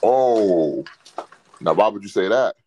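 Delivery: normal talking voice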